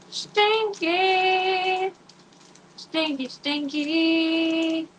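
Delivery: high singsong Stinky, stretched. Real, not acted. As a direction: tease the word Stinky up high. Teasing about stinky treats.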